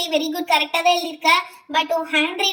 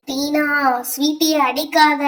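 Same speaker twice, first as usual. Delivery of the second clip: menacing grandmother spirit; ghostly warning; 2 semitones lower, 4 dB louder, slower than usual. Acted. Grandmother's spirit protecting her grandchild, menacing ghost voice. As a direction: warn her off like a possessing ghost.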